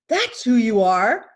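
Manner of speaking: loud, slow declaration; emphatic lion. Acted. Lion shows the cub its reflection, loud and emphatic. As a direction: drive it home as the big lion. Lion reveals the cub's identity.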